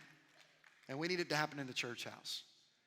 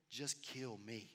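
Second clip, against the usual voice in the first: weary plea; 5 semitones lower, 6 dB quieter, slower than usual. Acted. Jonah's despairing prayer.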